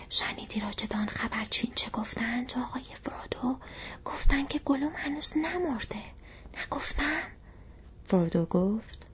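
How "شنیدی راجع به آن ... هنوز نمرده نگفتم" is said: soft whisper, lifted in pitch; Sam. A narrator's reading. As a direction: whisper a secret, hushed and eager to be proved right. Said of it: "اما این بار به نجوا گفت". (نجوای آهسته با صدای زیر)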